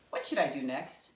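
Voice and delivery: ordinary talk, nothing marked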